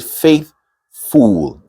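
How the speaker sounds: "faithful" with a long oo in the second syllable; mispronounced faithful. Shown as a demonstration. Teacher showing how some people mispronounce it, wrong "faithful". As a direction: say "faithful" ending in "fool". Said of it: "Two wrong things there".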